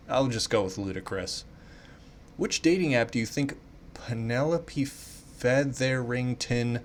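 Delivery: ordinary talk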